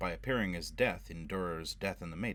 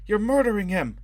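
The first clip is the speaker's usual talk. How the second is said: a shrill, much higher, louder scream; horrified scream; 12 dB louder than usual. A narrator's reading. Robin screaming at Foyle to stop the drowning. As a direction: scream at him in horror to stop.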